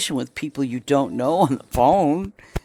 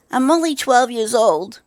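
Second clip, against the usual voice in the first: put-on higher kid voice for a spam caller; pretend child; 7 semitones higher than usual. Prank answer to a spam caller, kid voice. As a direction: play a twelve-year-old to fool them. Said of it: "So you say".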